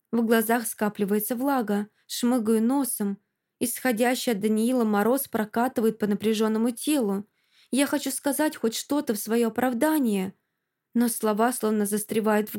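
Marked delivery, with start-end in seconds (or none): none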